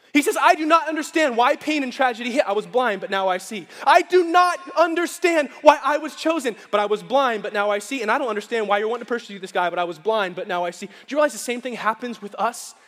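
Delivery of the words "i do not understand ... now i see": preached testimony. Acted. Blind man's testimony continues.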